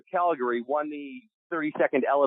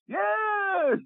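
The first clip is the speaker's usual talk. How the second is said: very high shouted "Yes"; Brian's voice. Retold or imitated. Producer confirming it's a drinking show, high shouted yes. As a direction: squeal it out way up high. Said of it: "And he speaks like this".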